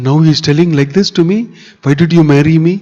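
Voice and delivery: losing control. Acted. Wife unable to bear her husband's teasing, upset. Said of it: "She can't control and says".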